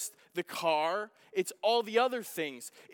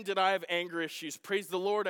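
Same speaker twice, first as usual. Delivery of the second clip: ordinary delivery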